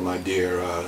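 normal talking voice

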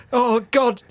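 ordinary talk

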